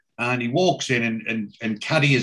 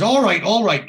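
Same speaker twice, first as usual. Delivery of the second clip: higher, louder mock grumble at the door; hammed-up groggy yell down the stairs; retold grumpy shout; 8 semitones higher, 6 dB louder than usual. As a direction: call out like a roused, annoyed man. Oz woken by knocking.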